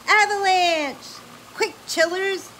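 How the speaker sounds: loud, high, drawn-out warning; urgent shout. Acted. Grand Dude warning the children of the avalanche, shouting. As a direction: sound the alarm. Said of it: "cried Grand Dude".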